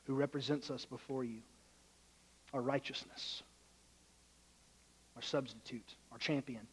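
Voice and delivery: low, hushed, reverent prayer. Real, not acted. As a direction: pray softly and unhurriedly, pausing between phrases. Leads a prayer.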